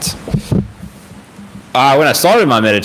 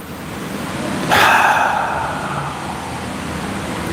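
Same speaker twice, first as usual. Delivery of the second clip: long breath out; 5 dB quieter than usual. Shown as a demonstration.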